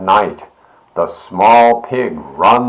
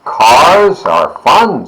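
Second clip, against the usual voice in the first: slow, loud and higher with glee; delighted; 6 semitones higher, 7 dB louder, slower than usual. A narrator's reading. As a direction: savour the fun. Pig delighting in junkyard.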